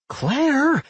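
high, loud sharp call of her name; yelling. A narrator's reading. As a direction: shout her name in alarm. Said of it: "Doc yelled".

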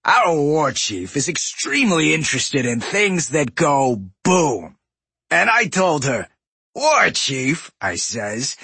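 gruff smug trade prince; raspy goblin boss. A narrator's reading. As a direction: leer and gloat, raspy. Voices Gallywix.

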